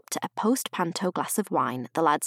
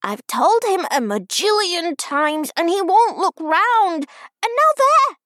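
put-on indignant child, climbing higher at the end; exasperated little girl; 10 semitones higher, 6 dB louder than usual. A narrator's reading. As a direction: protest like an indignant five-year-old. Small child exasperated at Peter.

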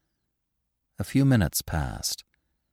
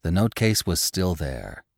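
usual speaking voice

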